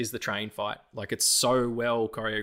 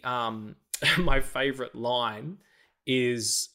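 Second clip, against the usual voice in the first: ordinary delivery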